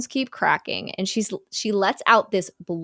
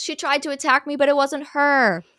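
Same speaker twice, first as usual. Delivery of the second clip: lightly voiced woman, pitched up; higher, retold victim voice; 6 semitones higher than usual. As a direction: imitate a woman pleading her case. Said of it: "And then a woman goes".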